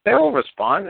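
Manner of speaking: high, mock-confident sing-song; mock-cheerful promise, pitched high; sarcastic. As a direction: pretend they'll surely answer, tongue in cheek. Mocking the caller's hope.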